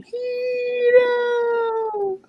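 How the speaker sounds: high-pitched, drawn-out mock yell; imitated girl's yell. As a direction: call the name out like the yelling girl. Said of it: "hear the girl yell his name".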